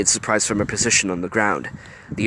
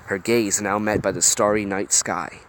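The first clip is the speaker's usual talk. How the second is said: usual speaking voice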